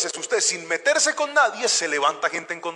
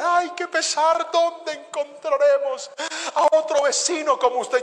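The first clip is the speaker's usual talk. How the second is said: mock lament; 8 semitones higher, 5 dB louder than usual. Neighbours lament his leaving.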